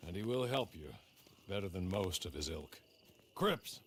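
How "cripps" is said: calling out. Acted. Butler waking old Cripps for the new boss, calling out high. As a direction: call him up sharply from across the camp. Servant rousing dozing old man.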